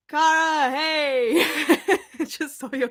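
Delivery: loud, high call with a laugh; excited greeting. Real, not acted. Streamer spotting a friend's name in chat, loud laughing hello. Calling out to a viewer.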